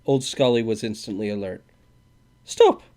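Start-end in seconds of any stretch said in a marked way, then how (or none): 2.4-2.8 sharp high shout. A narrator's reading. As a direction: shout to stop him. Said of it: "he shouted".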